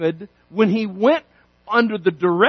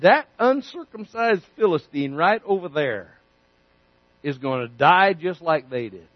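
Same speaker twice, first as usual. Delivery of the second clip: quiet confidence; 7 semitones lower, 6 dB quieter than usual. Acted. David points at Goliath.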